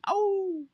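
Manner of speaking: long high hound-dog howl. Retold or imitated. Telling viewers about the dog on a nail, howling. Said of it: "and he's howling".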